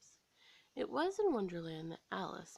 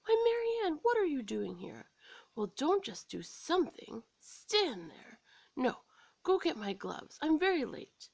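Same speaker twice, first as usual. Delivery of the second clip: high, louder, hurried bossing; fussy rabbit orders; 7 semitones higher, 5 dB louder than usual. A narrator's reading. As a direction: boss her about in a flap. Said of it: "he said sharply".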